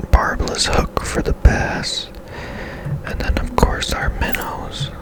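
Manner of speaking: normal talking voice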